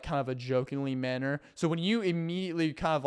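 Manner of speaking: usual speaking voice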